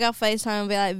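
normal talking voice

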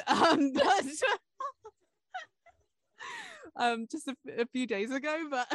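tipsy laughter rising high; breaking into giggles mid-sentence. Real, not acted. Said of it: "Fee is tipsy and is in hysterics".

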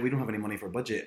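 fast dismissive brush-off; mock boss reply; rattled-off excuse. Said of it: "they'll be like".

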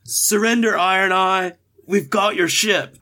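high-pitched heroic demand; calling out surrender. Acted. Fighter to Iron Eye after the hit, high called demand. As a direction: call it across the deck, pitched well up.

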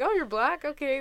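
loud, high retold surprise; mock surprise. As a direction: play their double take. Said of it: "they're like".

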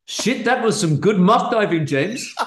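a South African guy, laughing; South African accent. Acted. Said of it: "That first line".

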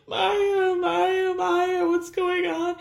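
high wailing sob, hammed-up; imitating a sobbing wail; mock sobbing. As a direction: cry her name out in a fake sob. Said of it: "sobbing like".

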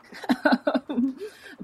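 bright rising laugh; a real, amused laugh. Real, not acted. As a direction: genuine laugh bursting out. Laughing about Toronto being peaceful.

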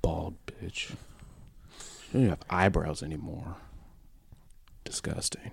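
whisper. Acted. Mock insulting whisper.

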